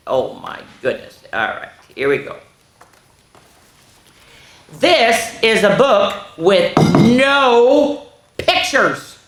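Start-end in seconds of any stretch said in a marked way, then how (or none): 4.7-9.2 loud, slow, emphatic announcement; dramatic story reading. A narrator's reading. Reading the title line to children, slow and grand. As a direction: announce it word by word, big and high. Reads the picture book to children.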